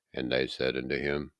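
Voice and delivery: ordinary delivery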